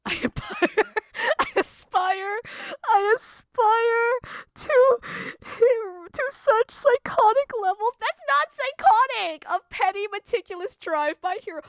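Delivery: loud, high, slow words broken by laughter; cracking up. Real, not acted. Streamer trying to read a message aloud, cracking up. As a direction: force it out loudly while losing composure. Cracking up reading out extravagant praise.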